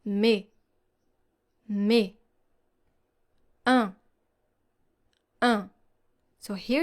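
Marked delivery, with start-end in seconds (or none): 0.0-2.1 mais said "mè", no s at the end; silent s. Shown as a demonstration. Teacher showing learners the silent s in Mais. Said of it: "Listen carefully". 3.6-5.7 Un said as a nasal vowel, no n sounded; silent n. Shown as a demonstration. Teacher showing learners the silent n in Un. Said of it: "Listen carefully".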